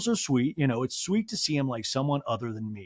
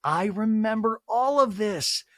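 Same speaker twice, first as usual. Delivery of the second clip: put-on dreamy teen; 9 semitones higher than usual. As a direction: swoon over the phone. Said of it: "you're just like".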